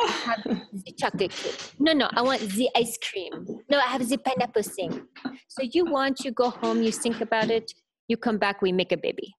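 imitated Frenchman telling his parable; broken English with a put-on French accent; thick French accent. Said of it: "this thick French accent".

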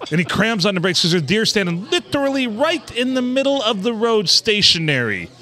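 ordinary delivery

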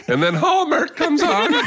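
high-pitched teasing sing-song; voice jumps up. Retold or imitated. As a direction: jump way up for the sappy part. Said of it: "his voice just goes up two octaves".

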